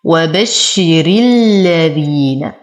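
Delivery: slow recited وبشر الذين; وبشر الذين joined, thin ra; tajweed recitation. Shown as a demonstration. Teacher repeats the linked phrase for students. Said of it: "تكون الراء مرققة".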